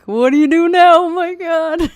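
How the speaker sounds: high, loud mock-amazed outburst; mock triumph. Co-host mocking a flat-earth video to her guest, mock triumph.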